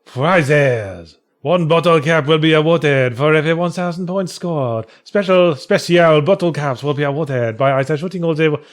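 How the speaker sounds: a light put-on Chinese accent reading rules. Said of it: "turning into a racist Chinese accent".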